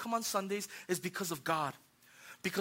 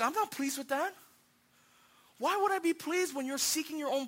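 higher-pitched, indignant insistence; displeased God; 6 semitones higher, 3 dB quieter than usual. Acted. God rebuking self-seeking worshippers, indignant. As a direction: stress each claim, affronted.

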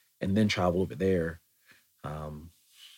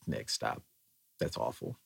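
deadpan friend; 7 semitones lower, 7 dB quieter than usual. Retold or imitated. Friends reacting to bad accent.

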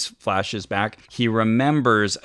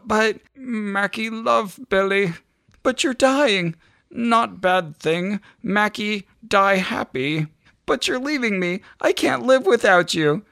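much higher and very slow, a weak loving dog; dying dog Mackie; 12 semitones higher than usual. Acted. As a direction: stretch each word out high and tender like a dying dog. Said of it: "This is how Mackie talks".

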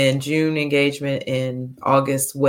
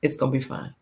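soft reassurance; 9 dB quieter, faster than usual. Retold or imitated. Reassuring mom about marriage.

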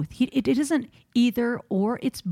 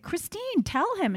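high, softer appeal to a friend; retold plea for help; 11 semitones higher, 4 dB quieter, slower than usual. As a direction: act out begging a friend to step in. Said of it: "like, help because I".

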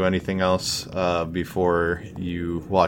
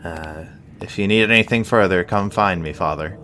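the healer curtly takes leave of the priest, raised voice; 3 semitones higher, 7 dB louder than usual. Acted. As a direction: wrap it up curtly. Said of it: "he nods, uh, curtly and says".

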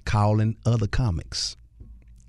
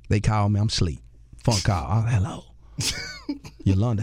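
ordinary talk

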